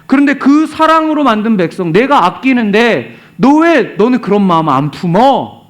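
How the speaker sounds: acted hurt reproach; God reproaching. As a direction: press him with an exasperated question. Acts God reproaching Jonah.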